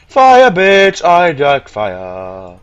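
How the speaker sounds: loud, high yell, slowing into a long drawl; gleeful shout. Real, not acted. Player discovers he can manipulate fire, gleeful. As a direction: crow it, stretching out the words. Excited about fire magic.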